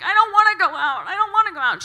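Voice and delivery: high, loud petulant whine; shouted childish protest; loud whiny refusal. Retold or imitated. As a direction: yell it down to her from the window. Refusing friend from window.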